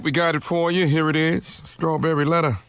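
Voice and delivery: normal talking voice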